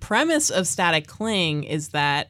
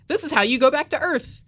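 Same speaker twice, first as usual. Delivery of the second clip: ordinary delivery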